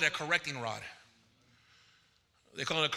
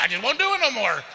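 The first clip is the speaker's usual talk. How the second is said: loud and higher, amused exasperation; worked-up retelling; 6 semitones higher, 6 dB louder, faster than usual. Retold or imitated. Minister telling congregation how he gave in, loudly baffled. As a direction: throw it out loudly, comically baffled.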